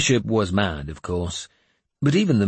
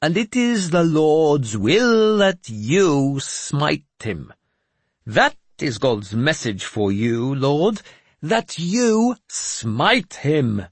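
very high, loud, very slow chant; prophesy from the pulpit; solemn intoning; intoned; 7 semitones higher, 4 dB louder, much slower than usual. Acted. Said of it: "Jeremias intoned".

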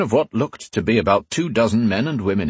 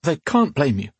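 startled protest, pitched up; 6 semitones higher than usual. A narrator's reading. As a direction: object in higher-voiced disbelief.

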